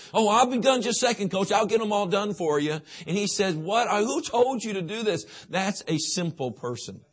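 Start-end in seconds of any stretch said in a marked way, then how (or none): 0.1-2.8 a hint of a sweet naive teen girl; eager young girlfriend. Wife voiced in baseball story. 3.6-5.2 higher, lightly voiced baffled coach; a touch of an incredulous coach; puzzled coach. Coach voiced catching her.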